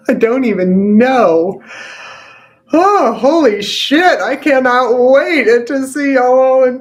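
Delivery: overjoyed outburst. Real, not acted. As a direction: gush like you can't contain it. Overwhelmed joy after session.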